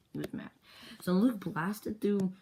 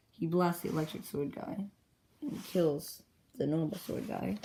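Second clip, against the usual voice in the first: ordinary delivery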